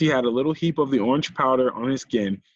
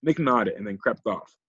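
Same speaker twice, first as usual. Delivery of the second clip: usual speaking voice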